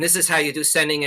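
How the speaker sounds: fast, run together with no gaps; jumbled together. Shown as a demonstration. Showing the class bad spacing, words rushed together. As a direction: cram the words together like sloppy sending. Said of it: "I started to talk like this to you guys".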